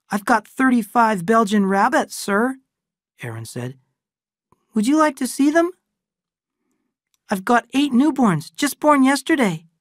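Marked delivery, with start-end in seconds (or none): 0.1-2.6 put-on high, eager child's voice; a shy boy proudly showing his rabbits; eager young boy. Young boy Aaron speaks. 4.7-9.7 put-on high, eager child's voice; a shy boy proudly showing his rabbits; eager young boy. Aaron offers his rabbits.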